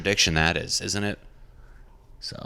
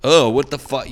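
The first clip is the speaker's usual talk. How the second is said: loud, higher mock outrage; exasperated bitching; 5 semitones higher, 8 dB louder, slower than usual. Comic peeling off layers of a problem, loudly exasperated. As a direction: blurt it out in indignant disbelief. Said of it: "I'm bitching at it".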